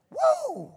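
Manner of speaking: very high, joyful whoop; excited whoop. Real, not acted. Excited preacher whooping at the congregation, high woo. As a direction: let out a thrilled woo. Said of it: "I'm getting real excited".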